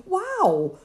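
high, loud burst of wonder; amazed wow. A narrator's reading. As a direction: gasp it out in pure amazement. Amazed at angel's visit.

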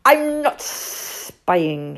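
slow, loud snake hiss with long S sounds; Sid, hissing. Acted. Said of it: "hissed Sid".

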